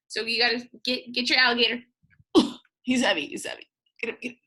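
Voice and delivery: ordinary delivery, nothing marked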